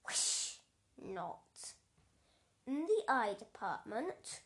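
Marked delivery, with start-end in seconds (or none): none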